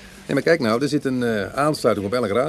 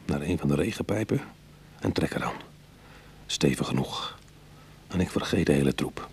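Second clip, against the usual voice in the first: story narration; 4 semitones lower than usual. A narrator's reading. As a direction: tell it like a memory, low and even. Ik-verteller van het verhaal. (rustige vertelstem van de inbreker)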